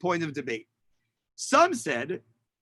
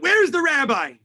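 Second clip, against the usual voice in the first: raised, higher-pitched demand; retold yell; 8 semitones higher, 5 dB louder than usual. German officer demanding the rabbi from the congregation, imitated yell. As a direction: call it out loudly, imitating a hostile officer. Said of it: "who yells out".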